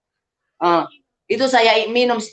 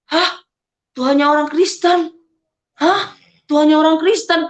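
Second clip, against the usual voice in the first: shocked disbelief; 5 semitones higher than usual. Retold or imitated. Retelling her shocked reaction.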